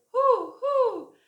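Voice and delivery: high loud owl hollering; hammed-up owl call; owl hoot. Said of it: "who hollered".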